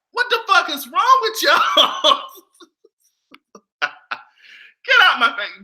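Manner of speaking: slow laughing protest. Real, not acted. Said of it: "laughing at chat".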